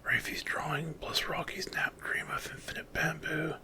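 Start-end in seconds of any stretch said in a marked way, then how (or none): none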